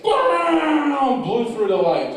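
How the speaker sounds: high, drawn-out comic roar; exaggerated booming burst, pitched high; mock explosive shout. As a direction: blast it out, long and loud. Acts out huge thing bursting through.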